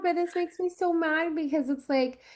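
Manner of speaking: hurting over a sad story; upset and tearful. Real, not acted. Co-host reacting to a dying foster father, tearful and upset.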